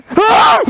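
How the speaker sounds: sudden high terrified scream; startled scream. Real, not acted. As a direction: shriek in fright. Sudden fright in the dark.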